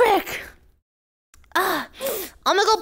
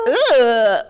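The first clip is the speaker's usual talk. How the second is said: pained groan after tasting; disgusted groan; 6 semitones lower than usual. Real, not acted. Reacting to disgusting soup.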